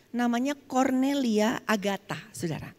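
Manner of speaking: normal talking voice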